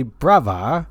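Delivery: put-on British "brava"; British accent. Shown as a demonstration. Co-host shows his accent warm-up word, mock British accent. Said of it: "to start my British accent every time".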